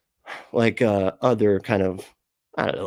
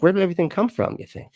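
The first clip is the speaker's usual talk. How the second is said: higher-pitched naive musing; primitive man wondering; 9 semitones higher, faster than usual. Retold or imitated. Streamer voicing primitive man again, naive wonder. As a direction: ask it like a wide-eyed early human. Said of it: "you got this primitive man".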